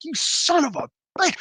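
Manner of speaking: high, strained reenacted curse; angry outburst. Retells turning angrily on the attackers.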